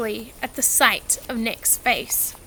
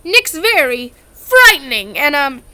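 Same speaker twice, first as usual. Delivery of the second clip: hurriedly; 2 semitones higher, 7 dB louder than usual. A narrator's reading. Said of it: "said Harry hurriedly".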